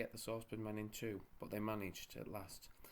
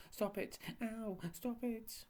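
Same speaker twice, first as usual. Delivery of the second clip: high frantic yelling; 12 semitones higher, slower than usual. A narrator's reading. Attacked, yelling above.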